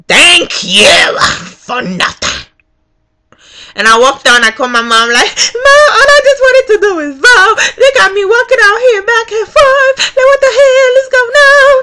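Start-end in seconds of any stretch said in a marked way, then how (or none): 0.1-2.5 slow, drawn-out mock thanks; sarcastic. Voter walking out on the worker, sarcastic. 5.5-11.7 high, hammed-up crying complaint; mock wailing. Voter phoning mom for sympathy, mock wailing.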